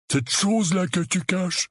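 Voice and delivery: high-pitched loutish accusation; accusing Dudley. Acted. (accusation de petite brute, voix aiguë)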